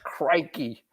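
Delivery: a light put-on Australian accent; hammed-up Australian accent; mock Australian accent. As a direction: play the cliché Aussie, Australian accent. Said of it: "every second word was like".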